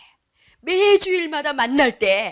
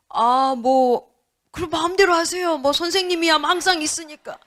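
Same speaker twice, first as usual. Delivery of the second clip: dismissive pastor; 2 semitones higher, 4 dB louder than usual. Acted. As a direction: brush the teacher off lazily. 무심한 목사 흉내. (교사를 대충 무시하는 목사 말투)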